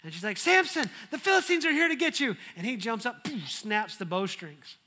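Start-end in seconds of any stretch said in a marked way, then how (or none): 0.4-2.4 high, loud, fast warning cry; mock alarm. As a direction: yell to wake him, hammed up. Delilah sounding the alarm.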